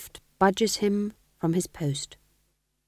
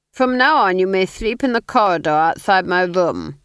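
louder, grandly generous lord; gracious Duke; 2 semitones higher, 8 dB louder than usual. Acted. Duke granting the fool a favour, grand and generous. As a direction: bestow it like a great favour.

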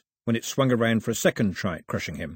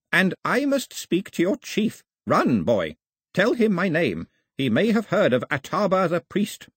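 put-on old priest voice; 8 semitones higher than usual. Old priest demands the chief.

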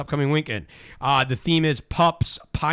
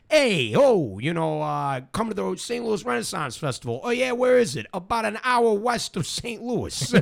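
higher-pitched mock back-and-forth; mock conversation; 7 semitones higher than usual. Host mimicking people discussing the festival, mock conversation. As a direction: act out both sides in a raised voice. Acted festival invitation and reply.

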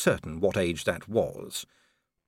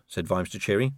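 ordinary delivery

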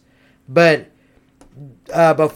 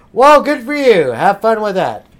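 ordinary talk

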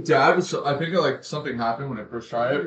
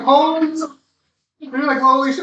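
mock shout; 10 semitones higher, 10 dB louder than usual. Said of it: "they were just like".

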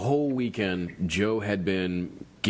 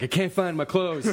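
higher whiny Joe voice, lightly voiced; mock Joe; 7 semitones higher than usual. Friend voicing Joe searching the beach, whiny. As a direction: play the lost naked friend.

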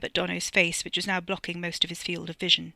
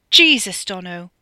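startled outburst, then dropping back; alarmed exclamation; 12 dB louder, slower than usual. A narrator's reading. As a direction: cry out in shocked reproof. Alarmed passenger snaps at pistol.

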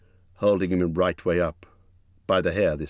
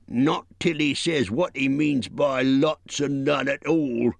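troll voice; 8 semitones higher, slower than usual. A narrator's reading. Bert refuses.

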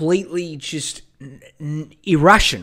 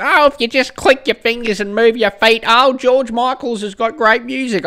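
higher, louder mocking older-listener voice; mock boomer; 8 semitones higher, 5 dB louder than usual. Zoomer mocking boomers praising [name], high boomer voice. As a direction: mimic a gushing boomer, pitched up. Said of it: "one of these boomers being like".

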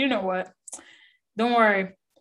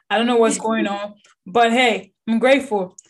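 normal talking voice

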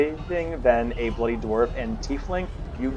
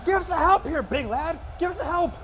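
much higher, effortful character voice; straining crew worker. Acted. Worker asking big lad for help, high strained voice. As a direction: play a labourer straining at a load.

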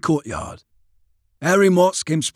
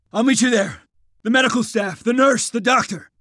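raised, hurried-sounding pitch; urgent reply; 9 semitones higher than usual. A narrator's reading. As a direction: insist on fetching the others. Lieutenant replies amid the retreat.

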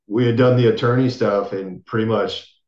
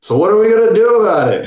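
much higher and louder, eager and driven; energetic woman's voice; 11 semitones higher, 6 dB louder, faster than usual. Retold or imitated. Lawyer cuts into the call, loud and high with eagerness. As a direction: burst in from the background ready to fight. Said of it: "you just hear this voice go".

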